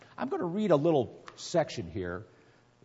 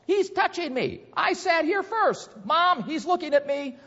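put-on high whiny kid complaining to mom; whiny tattling little sibling; 6 dB louder than usual. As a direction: tattle in a much higher, whiny child voice. Reading kids' squabbles aloud.

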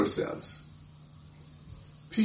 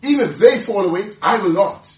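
cocky vow; 4 semitones higher, 7 dB louder than usual. Acted. Peter's boast repeated.

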